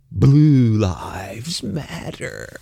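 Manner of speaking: very slow, stretched, whispery slogan; mock awed whisper. Host ridiculing the slogan after blue people rant, mock whisper. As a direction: draw every word out in an airy mock hush. Mockingly repeats slogan, drawn out.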